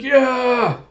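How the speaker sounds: high, drawn-out whoop; acted cheer. Retelling two players landing a hit, mock triumphant cheer. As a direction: cheer as if the point just landed.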